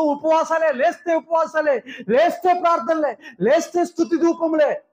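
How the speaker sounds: preacher stacking praise, pitch climbing; building sermon. Acted.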